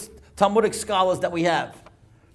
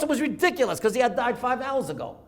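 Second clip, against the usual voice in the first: ordinary delivery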